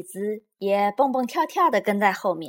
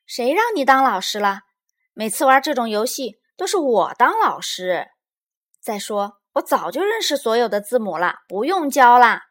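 loud, raised-pitch objection; indignant protest; 4 semitones higher, 10 dB louder than usual. A narrator's reading. Little Bear disputes who gets to be teacher, protesting. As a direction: argue back indignantly. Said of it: "小熊妹妹抗议道".

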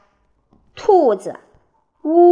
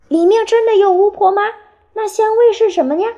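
high, fast, louder curious animals; 好奇追问; 10 semitones higher, 5 dB louder, faster than usual. A narrator's reading. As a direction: fire eager questions in a high voice. Animals eagerly question the hedgehog. (又高又快地好奇追问)

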